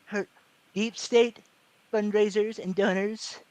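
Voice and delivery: slow Trump, broken into chunks; Trump impression. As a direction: drag it out like Trump, pausing between words. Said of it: "that's kinda how he talks".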